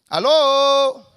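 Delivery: calling out. Real, not acted. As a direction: call out to an unresponsive congregation. Calling for the congregation's response. (llamando en voz alta al público)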